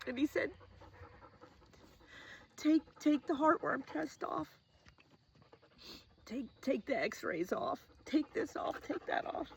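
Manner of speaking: high, soft, choked voice; halting, barely getting the words out through tears; real tearfulness. Real, not acted. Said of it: "I'm gonna cry".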